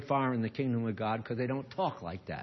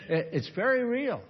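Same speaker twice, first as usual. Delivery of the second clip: high laugh into the words; amused laugh; 9 semitones higher than usual. Real, not acted. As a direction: laugh as you say it. Laughing after the story.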